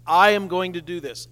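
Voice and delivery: "I" punched loud at the start; firm, loud stress on "I"; emphatic I. Shown as a demonstration. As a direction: declare it with weight on "I". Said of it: "is emphatic".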